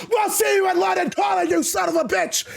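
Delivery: high, shouted parting insult; angry shout. Acted. Vince shouts a parting threat at Damien, angry. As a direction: hurl the threat at him.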